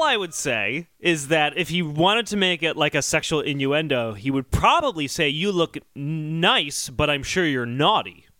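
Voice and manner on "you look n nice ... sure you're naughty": ordinary talk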